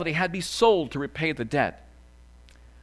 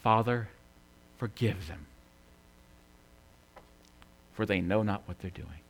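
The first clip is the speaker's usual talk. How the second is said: low, hushed plea with long pauses; understated dying prayer; 7 semitones lower, 7 dB quieter than usual. Retold or imitated. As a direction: pray it softly and haltingly, as if nearly spent. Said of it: "as Jesus gasped for air".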